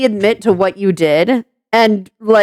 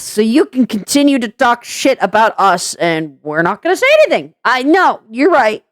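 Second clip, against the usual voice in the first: ordinary delivery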